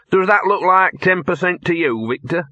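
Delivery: high-pitched sly haggler's voice; calculating talking-dog character; wheeler-dealer dog. Acted. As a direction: query the cut like a canny mutt. Gaspode weighs up his cut.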